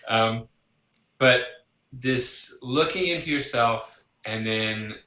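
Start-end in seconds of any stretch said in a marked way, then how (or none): none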